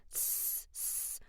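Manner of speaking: "tss" as a sharp hissed s between the teeth; sibilant s demo. Shown as a demonstration. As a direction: hiss out "tss, tss" with the tongue close behind the teeth. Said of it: "women have more of a sibilant".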